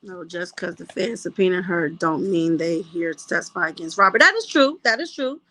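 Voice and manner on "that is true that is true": high, loud burst of agreement; loud excited yes; emphatic agreement. Real, not acted. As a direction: jump in to agree, fired up.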